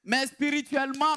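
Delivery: ordinary talk, nothing marked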